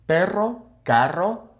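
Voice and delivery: "Perro, carro" trilled, each rising like a list; rolled R. Shown as a demonstration. Reviewing practice words, trilled "Perro, carro". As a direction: roll both R's in "Perro, carro". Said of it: "when you roll the R".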